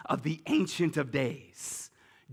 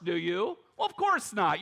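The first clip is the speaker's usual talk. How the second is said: usual speaking voice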